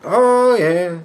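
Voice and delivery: put-on high, offhand old lady; lightly voiced grandmother; voicing grandma. Grandson voicing grandma's shrug, high offhand old lady. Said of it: "she goes".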